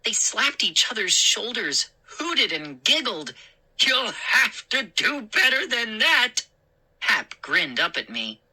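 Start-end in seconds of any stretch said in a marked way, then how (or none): 3.8-6.5 mocking gnome. Gnome mocks the boy.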